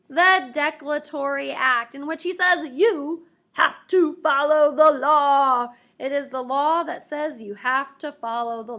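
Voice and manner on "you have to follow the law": loud, slow royal command; booming slow decree from the throne; king's decree. Acted. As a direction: lay down the law like a stern king.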